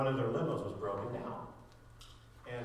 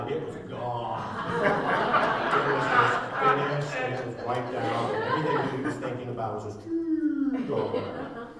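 talking through real laughter, louder; gleeful laughing retelling; 3 semitones higher, 8 dB louder than usual. Real, not acted. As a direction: laugh it out while telling it. Laughing at the foiled date.

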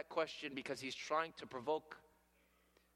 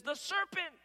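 blaming squeal; 13 semitones higher, 3 dB louder than usual. Acted. Eve shifts blame to serpent.

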